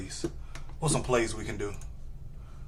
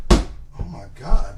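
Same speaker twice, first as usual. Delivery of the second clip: ordinary talk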